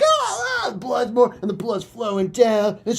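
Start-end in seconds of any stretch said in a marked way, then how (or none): 0.0-0.7 high berserk screech; mock bird screech; bird shriek.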